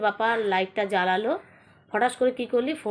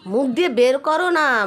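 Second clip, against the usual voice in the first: slow, drawn-out loud demand, raised pitch; loud insistent urging; 4 semitones higher, 7 dB louder, slower than usual. Real, not acted. Woman urges smoker to let smoke out, loud insistence. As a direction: stretch the order out insistently.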